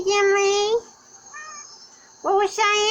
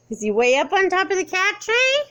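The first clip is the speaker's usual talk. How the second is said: ordinary talk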